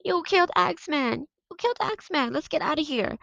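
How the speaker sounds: high mock yell of panic; retold yelling. Boys fleeing the carnival tent, mock panicked yell. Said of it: "So we start yelling".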